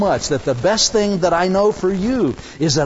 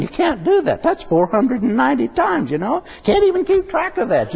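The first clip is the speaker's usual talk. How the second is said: higher, protesting Peter; exasperated Peter; 7 semitones higher than usual. Retold or imitated. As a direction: complain that it can't be done, pitched up.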